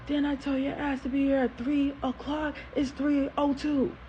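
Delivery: high-pitched mock telling-off; angry dad scolding, short of a scream; imitating screaming dad. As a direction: demand it like a father catching a latecomer. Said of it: "he starts screaming".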